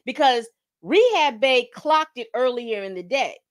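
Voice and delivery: ordinary talk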